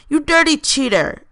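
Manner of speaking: fake angry voice. Turner teases Graham during their race, mock angry. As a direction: pretend to be mad, clearly joking. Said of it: "in an angry voice that Graham knew was fake".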